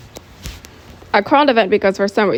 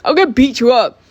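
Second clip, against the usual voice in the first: louder, higher acted threat; mock-furious shout; screamed threat; 4 semitones higher, 5 dB louder than usual. A narrator's reading. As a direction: yell the threat in a raised pitch. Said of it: "He screams".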